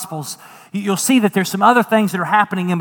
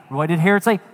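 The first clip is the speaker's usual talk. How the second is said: usual speaking voice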